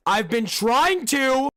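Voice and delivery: high, drawn-out acted cry; exasperated outburst. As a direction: burst out with it, high and slow. Acts out character's outburst.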